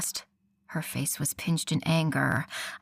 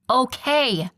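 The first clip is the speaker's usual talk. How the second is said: higher, loud impatient yell; 5 semitones higher, 6 dB louder than usual. Acted. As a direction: snap it out loud. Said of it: "Beatrice yelled".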